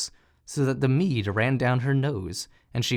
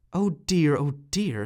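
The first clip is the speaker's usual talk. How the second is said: sigh; 2 semitones higher than usual. A narrator's reading. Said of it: "sighed little Klaus".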